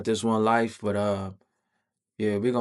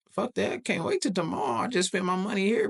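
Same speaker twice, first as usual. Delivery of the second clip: high-pitched, exasperated objection; acted-out disbelieving complaint; indignant protest; 10 semitones higher than usual.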